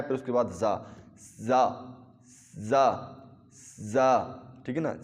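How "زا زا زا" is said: زا with a little s-hiss at the start. Shown as a demonstration. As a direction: lead into زا with a slight s. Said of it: "پہلے تھوڑی سی سی کی آواز آئے".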